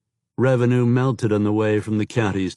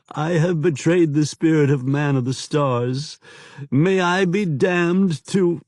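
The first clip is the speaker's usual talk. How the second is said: pleading southern informer; 6 semitones higher than usual. A narrator's reading. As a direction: confess as if begging forgiveness.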